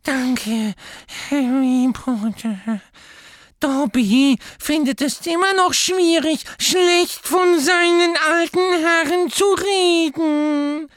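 very high, loud elf voice; Dobby thanking Harry after being held back; grateful house-elf. A narrator's reading. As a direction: squeak it out breathless and thankful.

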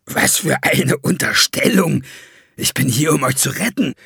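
mock indignation. A narrator's reading. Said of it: "mit ehrlicher Entrüstung".

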